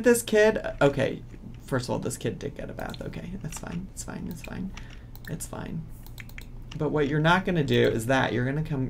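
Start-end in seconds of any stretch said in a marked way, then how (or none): 3.0-5.8 much softer, breathy whisper; quietly talking herself down; whispered self-soothing. Real, not acted. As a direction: whisper it to calm yourself down. Calming herself after anger.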